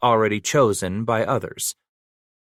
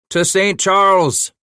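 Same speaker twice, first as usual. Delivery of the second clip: put-on loud, high Scottish-accented cry to the caravan; rally the men in a Scottish accent; Scottish rallying shout; 10 semitones higher, 6 dB louder than usual. A narrator's reading. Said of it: "The Scottish accent rose in the air".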